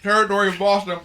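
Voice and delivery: chewing while announcing; announcing through a mouthful; mouth full. Real, not acted. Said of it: "That's what it sounds like".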